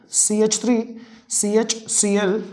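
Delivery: slow, spelling out each letter; slow dictation. Shown as a demonstration. Tutor dictating the formula to students, slowly and clearly. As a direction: dictate it piece by piece. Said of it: "repeats formula for dictation".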